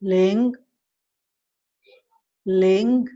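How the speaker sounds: लिंग said as Mandarin líng, rising; Chinese ling. Shown as a demonstration. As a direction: lift लिंग upward at the end. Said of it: "वन को हम पढ़ेंगे". (लिंग, ऊपर उठता स्वर)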